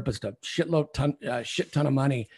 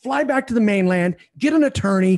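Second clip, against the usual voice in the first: loud, higher furious tirade; livid rant; 6 semitones higher, 6 dB louder than usual. Retold or imitated. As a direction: yell it all at her. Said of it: "I was livid".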